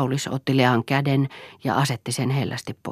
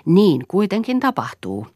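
lively, higher and louder; 8 semitones higher, 5 dB louder than usual. A narrator's reading. Aulis insisting to Lea that it will happen, lively. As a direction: answer with a lift of liveliness. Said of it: "sanoi Aulis eloisasti".

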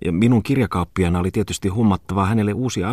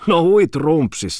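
mock exclamation; 11 semitones higher, 4 dB louder, slower than usual. Comic exclamation.

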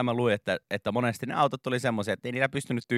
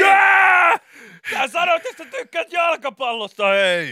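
much higher, loud slow cheering; much higher, louder gushing shout; hyped host shout; 8 dB louder than usual. Retold or imitated. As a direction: sell the reveal at full volume.

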